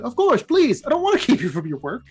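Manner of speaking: high, eager, over-accommodating reply; hammed-up nervous courtesy; flustered politeness. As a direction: rush to be obliging in a mock fluster. Flustered character plays along politely.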